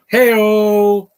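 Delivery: loud, high party cheer; hey-o cheer. Real, not acted. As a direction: yell it like a punchline. Cheering a Joker jab.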